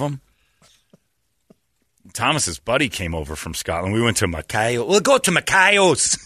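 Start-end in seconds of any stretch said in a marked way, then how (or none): 4.8-6.2 high, drawn-out Scottish burr; hammed-up eager Scot; mock Scottish accent. Imitating a Scottish visitor picking a place, mock Scottish accent.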